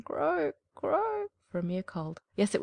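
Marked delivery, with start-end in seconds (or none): 0.0-0.5 high croaking raven call. Acted. Raven on the fence crying croak, high. 0.7-1.3 much higher second raven call; higher raven croak. Acted. Raven on the fence croaking again, higher still.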